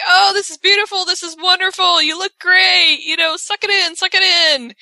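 high-pitched, hammed-up encouragement; mock gushing. Crew flattering posing Miz, mock gushing. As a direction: imitate a crew egging on a model. Retold crew cheering the model on.

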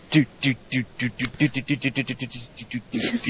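soft ticking do-do-do; quiet staccato run of clicks like a prize wheel; imitated spinning wheel. Co-host shows how the wheel kept spinning, imitated ticking. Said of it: "I distinctly watched it go".

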